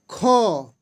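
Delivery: खो said slowly with a strong puff of breath after the kh; aspirated demo. Shown as a demonstration. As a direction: stretch out खो so the aspiration is heard. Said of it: "इसका आप बोल करके भी इसको छांट सकते हैं". (खो को ज़ोर की साँस के साथ बोलें)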